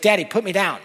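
high, loud mock toddler plea; insistent toddler. A toddler wants to walk herself, imitated insistence. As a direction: mimic a small child demanding.

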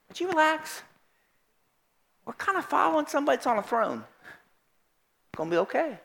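soft, soothing answer to panic; calm reassurance. Acted. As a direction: gently settle a worrier down. Calm Jesus soothes the panicked follower.

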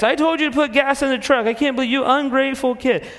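hammed-up angry father; mock scolding dad. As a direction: tell off the teen like a fed-up dad. Acted quarrel, dad scolding.

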